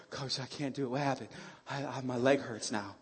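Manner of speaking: low, soft, lightly acted moan; mock complaining player. Hurt player pleading with coach, lightly mocked complaint. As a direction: grumble it quietly like a sorry player. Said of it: "as soon as you start feeling pain".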